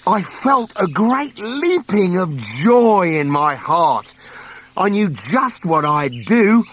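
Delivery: put-on young Onceler marvelling at the trees; wonder-struck memory. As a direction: relive the thrill of discovery. Onceler recalls finding trees.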